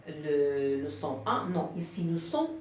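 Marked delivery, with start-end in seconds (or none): none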